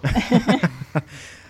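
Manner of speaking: loud, high laugh of relief; breathless laugh. Real, not acted. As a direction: burst out laughing, out of air. Relieved after the long list.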